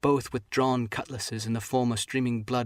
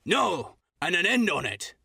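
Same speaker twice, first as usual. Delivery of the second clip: crying out; 6 semitones higher, slower than usual. Acted. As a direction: bark a final refusal. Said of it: "he cried once".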